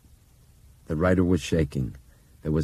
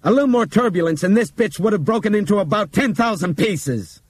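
higher, loud furious rant; angry scolding; 8 dB louder than usual. Acted. Pilot scolding Bob at the cockpit, angry rant. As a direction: tear into him.

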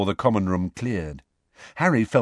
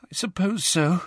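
high, small, unhappy voice; reluctantly agree, near tears; upset girl, pitched high; tearful; 8 semitones higher than usual. A narrator's reading. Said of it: "who looked tearful".

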